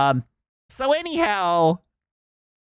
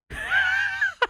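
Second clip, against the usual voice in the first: high shrieking laugh. Real, not acted. Bursts out laughing mid-rant.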